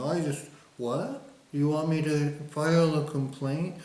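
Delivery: a hint of a wide-eyed innocent official; mock innocent. Mocking a clerk playing innocent about a complaint, faux-naive. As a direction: play dumb, lightly voiced. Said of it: "all blinky-eyed and innocent and going".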